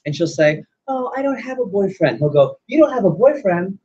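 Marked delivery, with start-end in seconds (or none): 0.9-2.2 high mock girly voice; put-on feminine reply, pitched way up; coy young lady. Voicing the young lady's reply. 2.7-3.8 high, put-on astonished question; hammed-up suitor's disbelief; mock surprise. Suitor reacts to her being single.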